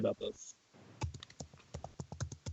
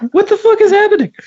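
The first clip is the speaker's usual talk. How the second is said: laughing confusion; 3 dB louder, faster than usual. Real, not acted. Confusion at broken call.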